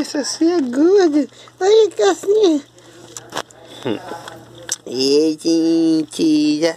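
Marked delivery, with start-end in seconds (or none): 0.0-2.6 high, sing-song cooing at the dogs; sweet high pet voice. Real, not acted.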